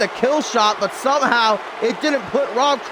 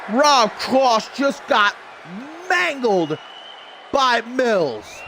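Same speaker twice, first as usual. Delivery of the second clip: excited play-by-play; 7 semitones higher, slower than usual. Acted. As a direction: call the action like a ringside announcer.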